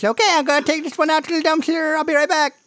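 put-on very high, eager kid voice; squeaky, rushed kid character; excited little brother. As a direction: gush like a thrilled little brother. Game master voices little brother.

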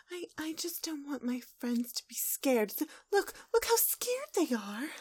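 high, breath-light timid whimper; Fluttershy. Acted. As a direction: plead in a high, whispery little voice. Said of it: "Twilight blushes".

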